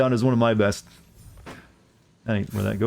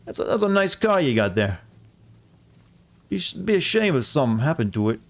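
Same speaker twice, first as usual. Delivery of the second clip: lightly voiced Australian mobster; veiled threat in mock Australian; Australian accent; 3 semitones higher than usual. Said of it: "give you the mob an Australian accent".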